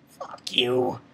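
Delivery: low, whispered contempt; hushed, disgusted swearing; whispered curse. Retold or imitated. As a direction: whisper it under your breath. Repeated curse, first whispered.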